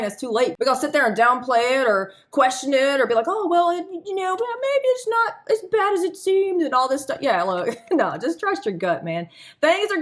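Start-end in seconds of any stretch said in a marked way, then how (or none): 3.3-6.6 high, hesitant mock voice of someone downplaying a hunch; mock self-doubter. Second-guessing your gut, high mock doubting voice. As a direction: play a dithering voice talking itself out of a bad feeling.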